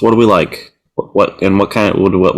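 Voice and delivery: ordinary delivery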